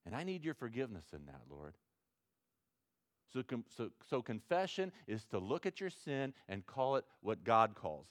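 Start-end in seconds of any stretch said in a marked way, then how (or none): 0.0-1.8 soft, quick plea for forgiveness; leise, schnelle Bitte um Vergebung; quiet prayer. Shown as a demonstration. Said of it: "that's confession".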